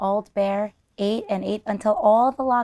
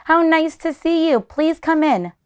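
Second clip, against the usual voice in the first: high, bright welcoming grandmother; delighted granny; 8 semitones higher, 6 dB louder than usual. Acted. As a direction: usher guests in happily. Grandmother welcomes her guests.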